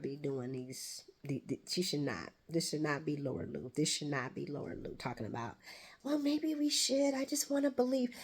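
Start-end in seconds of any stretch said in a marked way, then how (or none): 6.0-8.1 mock wishful pleading. Fan mocking a hopeful character, high naive voice. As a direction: imitate a credulous woman, pitched well up. Mocks Laura's wishful talk.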